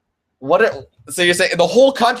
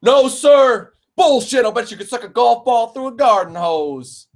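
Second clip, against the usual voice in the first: Full Metal Jacket quote; 8 semitones higher than usual. Acted. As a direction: shout it like a drill sergeant quoting the film. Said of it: "Full Metal Jacket".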